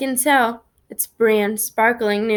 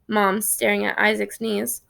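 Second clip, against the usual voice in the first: plain reading voice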